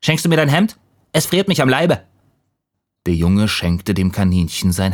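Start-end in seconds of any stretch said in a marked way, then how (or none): 0.0-2.0 small, high rabbit voice; little rabbit. A narrator's reading. Freezing rabbit asks the boy for his shirt, high little voice. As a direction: plead in a higher little-animal voice. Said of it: "kam ein kleines Kaninchen und sprach".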